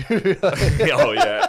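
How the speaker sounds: bursting out laughing. Real, not acted. Caught out by friend's tweet joke, laughing.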